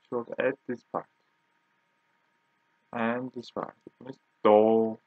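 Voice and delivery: ordinary delivery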